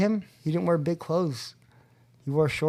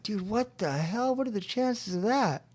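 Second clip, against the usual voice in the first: amazed disbelief; 6 semitones higher, 4 dB quieter than usual. Retold or imitated. Newcomer spots old friend walking into meeting, amazed. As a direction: marvel at the coincidence in a raised pitch.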